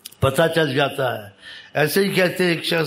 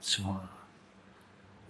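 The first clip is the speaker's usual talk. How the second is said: soft, slow whispered praise; awed whisper; 7 semitones lower, 19 dB quieter, much slower than usual. Real, not acted. Preacher marvels to listeners, awed whisper. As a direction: breathe it out in awe.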